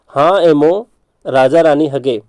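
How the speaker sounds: हा एमो slowly, pause, then राजा रानी हगे; two-part mnemonic drill. Shown as a demonstration. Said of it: "दो भाग में इसको ब्रेक करते हैं". (हा एमो राजा रानी हगे, धीरे, दो भागों में)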